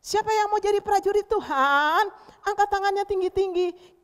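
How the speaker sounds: high, loud, stretched-out shout; rallying call. Real, not acted. Teacher asking children to raise hands, rousing shout. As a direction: call the whole room to volunteer.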